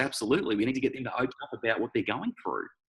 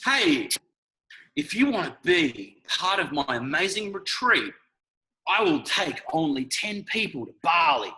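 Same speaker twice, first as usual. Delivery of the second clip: higher, slow hyped-up retreat pitch; cheesy trainer post; 6 semitones higher than usual. Retold or imitated. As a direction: sell it like a cheesy Facebook ad. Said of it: "it's like".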